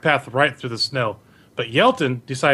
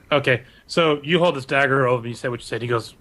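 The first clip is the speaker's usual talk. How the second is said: ordinary delivery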